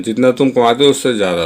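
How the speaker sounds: ordinary talk, nothing marked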